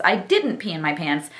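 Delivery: ordinary talk, nothing marked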